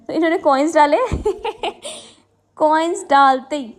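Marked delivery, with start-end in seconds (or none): 1.0-2.0 bright burst of laughter. Real, not acted. Vlogger laughing at husband's coin mistake. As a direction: laugh out at the silly moment.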